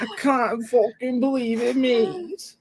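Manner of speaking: high whiny Scouse; complaining Scouse accent. Shown as a demonstration. Showing how Scousers always sound, high complaining Scouse. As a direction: moan in a mock Liverpool accent. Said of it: "they always sound like they're complaining".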